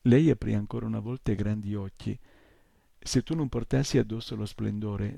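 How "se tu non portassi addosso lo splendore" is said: plain reading voice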